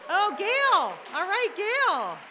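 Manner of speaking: loud, high drawn-out call; excited cheer. Real, not acted. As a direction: cheer the winner's name. Winner named.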